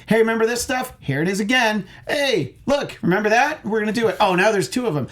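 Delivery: high, excited mock voice of the film; mocking the movie. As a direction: gush like a sequel shoving callbacks at you. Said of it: "it's all like".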